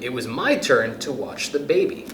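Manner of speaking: ordinary delivery, nothing marked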